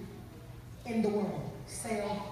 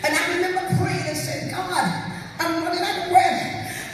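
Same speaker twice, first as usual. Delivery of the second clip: loud, high, half-sung sermon climax; full-force preaching; 10 semitones higher, 13 dB louder than usual. Acted. Preacher crying out the testimony to the congregation, loud and sung. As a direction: build to a sung whoop over the music.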